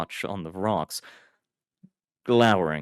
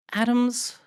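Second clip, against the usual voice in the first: high hesitant call; tentative; 4 dB louder than usual. A narrator's reading. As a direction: Iris calling Adams unsure. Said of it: "she said tentatively".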